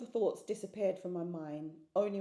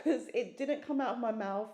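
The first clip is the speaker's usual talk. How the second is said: usual speaking voice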